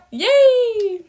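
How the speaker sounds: high, loud happy cheer; excited cheer. Pretend. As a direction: cheer like a kid. Solution ready, cheering.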